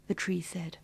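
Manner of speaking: usual speaking voice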